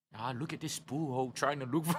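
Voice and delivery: soft, laughing play-by-play of the search; sneaky mocking commentary. Friend hiding behind a tent, mocking the searcher, soft laughing commentary. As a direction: snicker as you narrate from a hiding spot. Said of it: "doing his own commentary".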